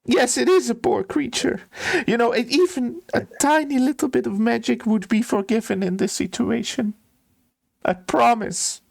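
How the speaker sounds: high, whimpering steward begging a favour; acted tearful plea; tearful pleading. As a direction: coax desperately in a high, weepy voice. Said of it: "he starts crying and sobbing".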